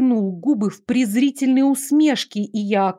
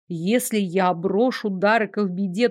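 usual speaking voice

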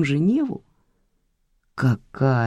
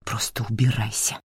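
quiet hiss; 4 semitones lower, 7 dB quieter than usual. Acted. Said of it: "прошипела она".

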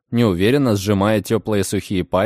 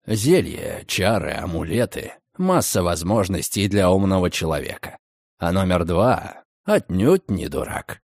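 low calm male voice; 6 semitones lower than usual. A narrator's reading. Redfern explains. (низкий спокойный голос)

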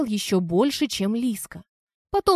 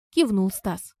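usual speaking voice

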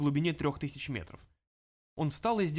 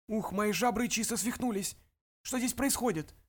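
gnome voice; 6 semitones higher than usual. Acted. Mulch voiced in audiobook.

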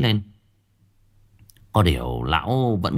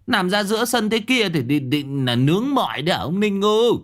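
exasperated neighbour fussing and scolding in a raised pitch; agitated neighbour; 6 semitones higher than usual. A narrator's reading. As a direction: call out high and scold in dismay.

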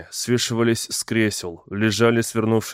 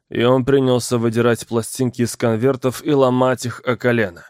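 ordinary delivery

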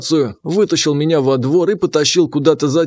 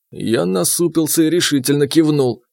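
plain reading voice

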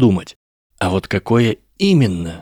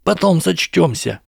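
high, panicky, voiced pleading; desperate whisper; 9 semitones higher than usual. A narrator's reading. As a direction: plead urgently, voice raised in pitch. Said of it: "отчаянно шептал Драко". (отчаянно упрашивать)

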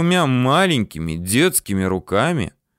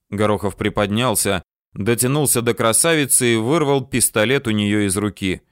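usual speaking voice

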